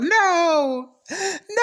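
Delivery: high, crying wail of no; mock wailing no. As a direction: cry out no like a devastated kid. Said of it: "I was like".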